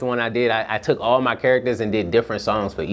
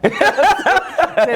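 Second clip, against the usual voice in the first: laughing; 4 dB louder than usual. Real, not acted. Laughing during retold exchange.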